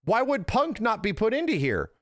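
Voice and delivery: hammed-up what-the-fuck at the person beside you; mock confrontation. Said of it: "look them in the eye and go".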